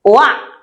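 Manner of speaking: "Oa" said WAH, a quick rising wa; oi sound. Shown as a demonstration. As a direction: give "Oa" as a bright wa sound. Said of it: "Because O I sounds what?".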